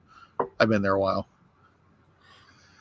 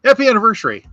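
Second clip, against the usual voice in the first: higher and louder, a breezy mock boss voice; cheery boss; 11 semitones higher, 7 dB louder, faster than usual. Boss handing the anniversary pin over, high and chipper. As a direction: hand it over all chipper and oblivious. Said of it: "and he goes".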